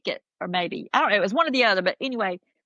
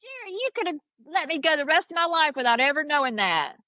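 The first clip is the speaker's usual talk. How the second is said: exasperated plea; 7 semitones higher than usual. Retold or imitated. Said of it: "I said".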